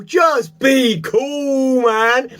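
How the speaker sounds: high, slow, drawn-out "Just be cool" shout; emphatic shout. Real, not acted. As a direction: belt it out like a plea. Urging listeners to keep calm.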